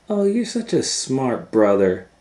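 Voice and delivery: put-on high, loud girlish praise; admiring little sister. A narrator's reading. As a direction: gush over her clever brother. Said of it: "said Gretel".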